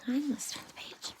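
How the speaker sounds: quick whisper to the sleepy son; whispered aside. Real, not acted. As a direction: murmur the aside, fast. Aside to son while reading.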